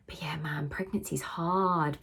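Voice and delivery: soft breathy whisper. Real, not acted. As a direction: drop to a whisper. Quiet reflective aside.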